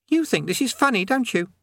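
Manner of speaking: put-on high young boy's voice; lightly high-pitched youth voice; boy Eric.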